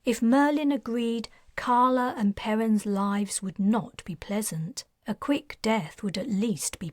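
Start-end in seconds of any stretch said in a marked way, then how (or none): none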